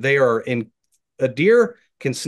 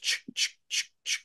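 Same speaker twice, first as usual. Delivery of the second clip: even, whispered sh-sh-sh like hooves in dry leaves; steady deer footsteps; 9 dB quieter than usual. Shown as a demonstration. Hunter shows listeners how a walking deer sounds: steady whispered shuffles. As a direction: keep a steady, unhurried rhythm of soft shuffles. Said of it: "it's not this consistent".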